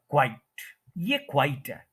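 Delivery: "क्वाइट" as kwite, like quite; single syllable. Shown as a demonstration. Teacher contrasting it with the word for silence, one-syllable pronunciation. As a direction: keep "क्वाइट" to one beat. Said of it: "ये क्वाइट है".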